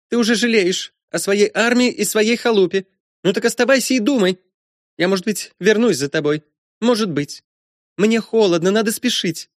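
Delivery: put-on very high girl's voice, scornful; petulant daughter dismissing her father; spiteful ghostly girl. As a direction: taunt the father in a high, thin girlish voice.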